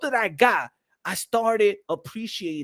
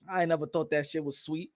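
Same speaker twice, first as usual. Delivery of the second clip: usual speaking voice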